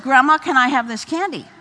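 lightly voiced toddler pressing his case; insistent little boy.

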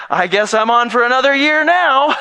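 high, loud comic protest; hammed-up wail of a trapped volunteer; mock outburst. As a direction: cry out in mock resignation. Mock complaint of guilted volunteer.

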